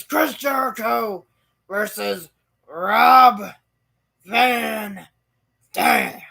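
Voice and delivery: high, loud, fast promo voice; over-the-top ring announcement; hyped announcer. Retold or imitated. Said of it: "Vince goes".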